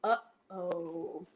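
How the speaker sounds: usual speaking voice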